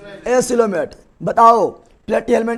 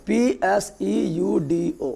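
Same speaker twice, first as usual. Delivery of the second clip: very slow, letter by letter, softer; spelling out; 6 dB quieter, much slower than usual. Shown as a demonstration. Teacher spelling pseudo for the class, slow spelled-out letters. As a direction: spell it out slowly, each letter separate.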